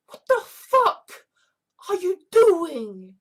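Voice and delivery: loud, high, drawn-out disbelief; exasperated outburst. Acted.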